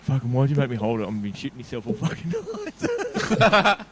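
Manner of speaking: high, slow panicked mate; mock panic, drawn out high; mate's panicked quote. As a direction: blurt it like a nervous best mate. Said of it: "He was like".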